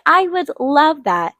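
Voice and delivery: retold thrilled acceptance; excited. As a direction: gush with slow, delighted emphasis. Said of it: "like, so excited".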